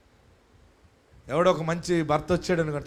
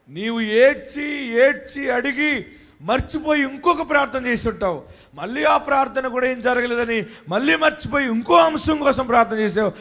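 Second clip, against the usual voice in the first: shouted preaching; 6 semitones higher, 6 dB louder than usual. Acted. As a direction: shout the blessing over the congregation. Preaching build. (సభకు గట్టిగా అరిచి చెప్పే ప్రసంగం)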